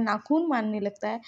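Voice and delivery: usual speaking voice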